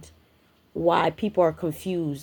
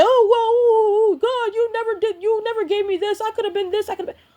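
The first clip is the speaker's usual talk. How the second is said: high, loud mock whine at God; sarcastic high whimper of a moaning tyrant; whiny complainer; 5 dB louder than usual. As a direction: mimic a self-pitying person complaining to God. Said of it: "be mad at God and say".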